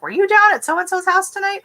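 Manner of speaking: high-pitched mock husband asking; imitating husband. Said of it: "And he's like".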